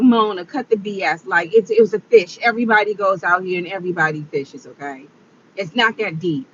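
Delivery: low-pitched, matter-of-fact self-scolding; self pep talk. Retold or imitated. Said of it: "trying to prep talk".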